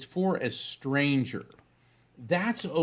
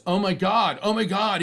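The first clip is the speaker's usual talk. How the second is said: startled disbelief; 7 semitones higher, 5 dB louder than usual. Retold or imitated. Young clerk startled by message.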